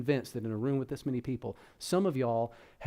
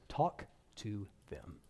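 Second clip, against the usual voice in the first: slow punchline reveal; 4 semitones lower, 7 dB quieter, slower than usual. Shown as a demonstration. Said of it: "Here it is".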